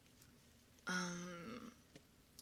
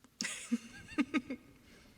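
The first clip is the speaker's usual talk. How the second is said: high giggle tailing off; real high-pitched laugh; 5 dB louder than usual. Real, not acted. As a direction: giggle brightly, then let it fade. Amused while chatting.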